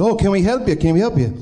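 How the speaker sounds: high, lightly Irish concern; bad Irish accent, worried pub crowd. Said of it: "I have to do it with an Irish, uh, bad Irish accent".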